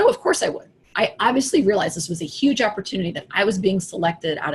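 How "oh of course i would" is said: eager yes. Retold or imitated. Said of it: "And I said".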